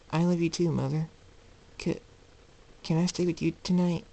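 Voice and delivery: put-on high, childlike voice; little colt. Colt asking his mother to let him stay, childlike. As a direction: pitch it up like a small child.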